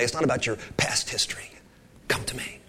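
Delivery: hushed, close and tender; whispered voice of Jesus beckoning; whispered invitation. Retold or imitated. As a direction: whisper the call to come. Jesus voiced to thirsty woman.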